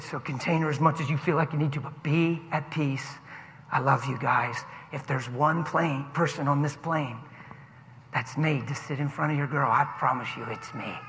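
soft, low, soothing voice; calm reassurance. Retold or imitated. Passenger telling dad to be at peace, soft and low. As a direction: quietly promise a worried parent it's fine.

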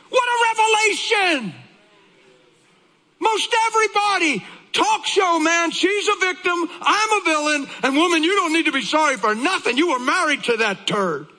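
high, full-voiced sermon shout; impassioned preaching. Real, not acted. Preacher exclaiming over his wife's revelation, fervent.

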